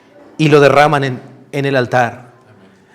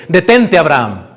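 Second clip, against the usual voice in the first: commanding God. Acted. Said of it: "Dios llega y le dice".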